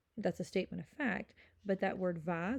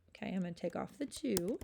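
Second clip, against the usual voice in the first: usual speaking voice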